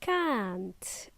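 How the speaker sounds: soft, lengthened CAN'T; long vowel. Shown as a demonstration. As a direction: model CAN'T slowly and softly. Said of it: "It's a long, uh, sound".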